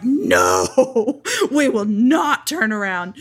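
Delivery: loud, drawn-out demonic refusal. Retold or imitated. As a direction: put on a demon voice, stretching the words. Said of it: "transitioned into my demon voice".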